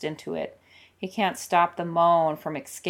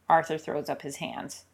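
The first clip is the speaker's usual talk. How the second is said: usual speaking voice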